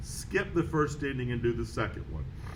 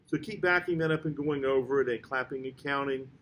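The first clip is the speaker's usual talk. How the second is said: ordinary talk, nothing marked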